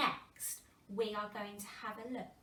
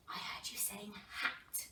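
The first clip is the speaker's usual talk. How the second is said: unvoiced secret whisper; 2 semitones lower, 8 dB quieter than usual. Shown as a demonstration. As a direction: breathe it out softly. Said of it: "whispered aside to children".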